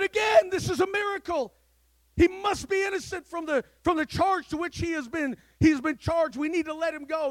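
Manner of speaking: high, loud cry of wonder; astonished shout. Acted. Executioners seeing a second miracle, shouting in astonishment. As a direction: shout it out, amazed all over.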